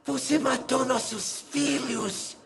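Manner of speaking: high, soft, very slow ghost moan; whisper-wail an accusation from the grave; hollow dead-wife voice; ghostly dead woman. A narrator's reading. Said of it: "Ela continuou".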